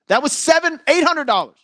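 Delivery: high, loud burst of real annoyance; pissed off. Real, not acted. Sports host on his lost bet, pissed off. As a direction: raise pitch and volume, aggrieved over lost money. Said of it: "that pissed me off".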